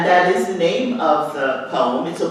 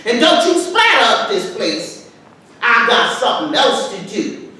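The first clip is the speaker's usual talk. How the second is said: dialect poem recital. Acted. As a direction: threaten the boy with the strap, in full dialect. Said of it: "recite the words to this poem".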